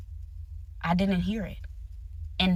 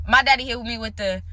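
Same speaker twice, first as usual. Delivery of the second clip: usual speaking voice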